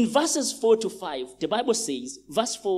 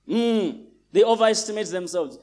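ordinary talk

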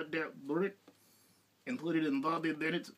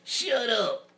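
loud shut up; 8 semitones higher, 8 dB louder than usual. Acted. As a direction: shout it, half joking. Playful retort after teasing.

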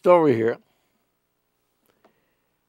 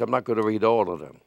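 quick aside; 6 semitones lower, 7 dB quieter, faster than usual. Real, not acted. Aside while reading.

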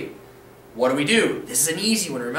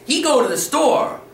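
"He go to the store" pitched higher and louder, rising end; wrong grammar demo; 7 semitones higher, 4 dB louder than usual. Shown as a demonstration. Teacher mimicking a learner's mistake for students, high rising voice. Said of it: "You don't wanna sound like this".

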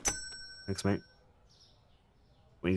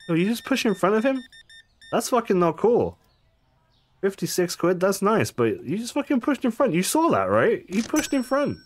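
much higher, outraged protest; indignant; 11 semitones higher than usual. Real, not acted. Cashier protesting a customer who pushed in front, high indignant outrage. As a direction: call out the queue-jumper in disbelief.

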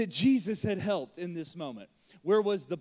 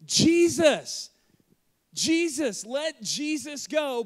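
higher, loud cry of Jesus, Jesus, let Jesus go; pleading shout; 7 semitones higher, 8 dB louder, much slower than usual. Acted. Preacher voicing a rational person in the crowd, loud pleading shout. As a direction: shout it like a crowd voice begging for his release. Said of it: "Any rational person would go".

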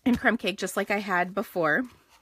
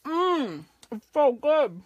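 high mmm while chewing; mouth full; 7 semitones higher, 6 dB louder, slower than usual. Real, not acted. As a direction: say it round a mouthful of cookie. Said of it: "I can talk with my mouth full".

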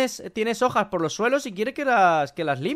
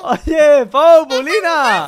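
loud, high, drawn-out call; llamando a voces; 6 semitones higher, 9 dB louder, much slower than usual. Pretend. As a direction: call after them, stretching the names. Llama a los que se van. (llamada fuerte, aguda y alargada)

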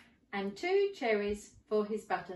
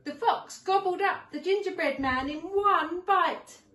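dramatic climax; 9 semitones higher, 6 dB louder than usual. A narrator's reading. Climax of the story.

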